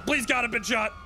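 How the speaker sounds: high, distressed begging; urgent high-pitched wounded plea; pained pleading. Pretend. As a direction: plead like you've been shot. Wounded character begging for help.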